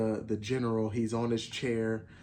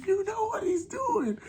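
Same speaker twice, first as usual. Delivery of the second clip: much higher again, almost a squeal; squealing excitement. Real, not acted. Fan repeating the tease to viewers, squealing high. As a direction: let the hype crack up to the top.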